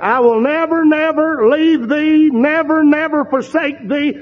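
stack the nevers, climbing each time; building emphasis. Shown as a demonstration. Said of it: "a structured emphasis there".

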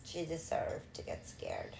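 quiet slow whispered aside. Real, not acted. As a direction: whisper it under your breath, slowly. Aside under breath.

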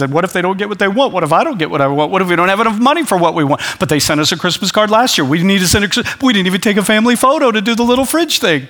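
rushed, rambling and half-laughing through the worries; mock frantic Christmas parent. Pastor mocks a stressed parent before Christmas, fast and laughing. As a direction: play a frazzled parent panicking over gifts, giggling. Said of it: "I'm just being silly".

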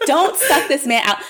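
hoog en lachend: nee, nee, niet doen; laughing outrage, pitch jumping way up; heated laughing protest. Real, not acted. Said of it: "I get very heated".

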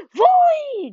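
high, very loud pouncing cry; fox's shout. A narrator's reading. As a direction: shout suddenly as you pounce. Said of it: "gridò Gustavo". (grido improvviso della volpe)

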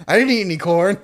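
high, loud, laughing movie line; Mike Myers quote. Pretend. Joking with friends, the Mike Myers line, laughing. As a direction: blurt the line through laughter. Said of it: "speaking of Mike Myers".

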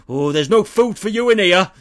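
a hint of a hostile Yorkshire local telling him to go; Yorkshire villager. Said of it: "They're like".

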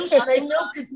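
mock almond milker.

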